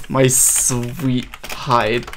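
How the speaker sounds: very slow, drawn-out words; savouring the phrase. Real, not acted. Streamer savouring a player's compliment, slow drawl. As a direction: stretch out each word, relishing it.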